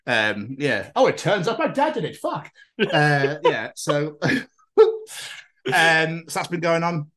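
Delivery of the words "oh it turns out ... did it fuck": high, hammed-up detective realizing his dad did it; mock shocked detective. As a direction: gasp out the twist in a much higher voice. Mock detective at evidence board.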